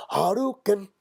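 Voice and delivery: high yelled Hadouken like a fighting game move; mock battle cry. Comic shouting Hadouken at his son as he fires a snot rocket. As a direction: shout Hadouken as a special attack.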